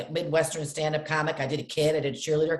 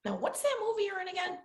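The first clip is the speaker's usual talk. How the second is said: mock puzzled parent; 12 semitones higher, 7 dB quieter than usual. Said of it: "my parent would be like".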